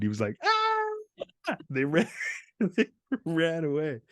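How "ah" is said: imitated high-pitched scream; kid's scream. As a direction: mimic a startled kid screaming.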